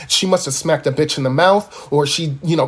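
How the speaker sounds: usual speaking voice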